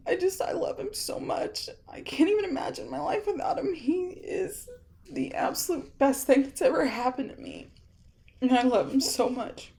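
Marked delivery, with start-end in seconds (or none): 0.1-7.7 high, soft, breaking into tears; real crying, choking out each word; words about her husband half-sobbed; tearful, voice breaking. Real, not acted. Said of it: "I'm getting a little teary".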